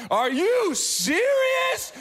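mock the furious wife, high and sharp; outraged wife. Wife catching husband snoring in labor room, high outraged scold. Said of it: "my wife was like".

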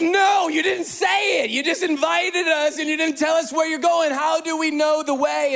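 exasperated disciples yelling, played for laughs; mock exasperated shout. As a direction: shout it like confused friends left out. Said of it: "It's like".

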